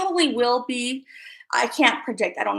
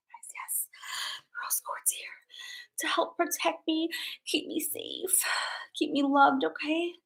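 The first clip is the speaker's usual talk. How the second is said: soft unvoiced whisper, asking for protection; hushed plea to the crystal; whispered prayer; 4 semitones higher, 10 dB quieter than usual. Real, not acted.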